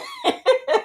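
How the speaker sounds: high, cackling laugh; real laughter. Real, not acted. Woman amused by her own retort to sister, laughing. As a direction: burst out laughing.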